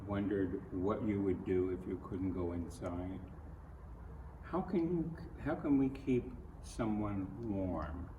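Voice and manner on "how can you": ordinary delivery, nothing marked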